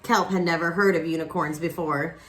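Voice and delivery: plain reading voice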